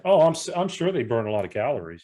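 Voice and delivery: ordinary talk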